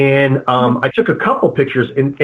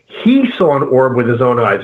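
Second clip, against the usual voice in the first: ordinary talk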